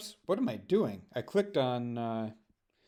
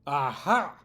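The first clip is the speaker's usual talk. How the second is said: gotcha exclamation; triumphant aha; 3 dB louder than usual. Real, not acted. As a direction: pounce on the slip. Catches guest's admission.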